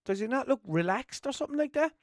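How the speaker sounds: high, hesitant voicing of himself; high unsure quote. Retelling his reply to his mate, high and unsure. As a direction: puzzle it out in a high voice. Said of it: "I was kinda like".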